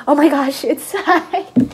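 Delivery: high, giggly mock alarm; hammed-up breathless announcement through a laugh; dramatic mom. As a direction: gush it as an overexcited mother. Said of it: "my mom is dramatic".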